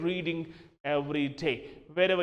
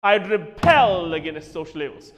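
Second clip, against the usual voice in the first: normal talking voice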